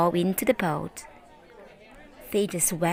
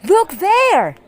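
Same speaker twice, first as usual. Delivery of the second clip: much higher, louder surprised call; 12 semitones higher, 10 dB louder than usual. Acted. Villager calling the crowd to see the animals, surprised shout. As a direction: point and shout it. Someone calls out spotting animals.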